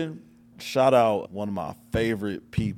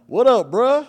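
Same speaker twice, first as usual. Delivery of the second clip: imitating a hyped greeting; 13 semitones higher, 9 dB louder, slower than usual. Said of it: "He was like".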